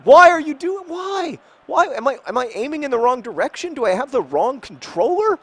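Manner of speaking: very high-pitched, loud squawk of disbelief; frantic yelling. Real, not acted. Gamer questioning his own aim and controller, frantic yelling. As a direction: shriek questions at the game in frustration.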